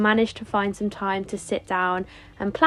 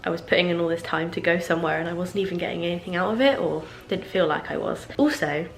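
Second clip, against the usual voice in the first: ordinary delivery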